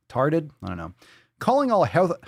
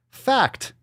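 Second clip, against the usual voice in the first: high, slow sarcastic reading of the fact; mocking read; 8 semitones higher, 3 dB louder, much slower than usual. Vlogger mocking a weight post, high and slow.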